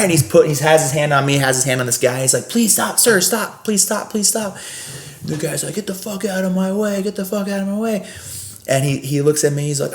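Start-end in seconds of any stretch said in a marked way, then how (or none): 2.5-4.6 higher-pitched imitation of a pleading colleague; pleading peacemaker. Colleague steps between two men, pleading. Gary steps in to stop fight. 5.7-8.1 higher, softer mock-angry voice; retold angry order. Guest orders the peacemaker aside, mock angry.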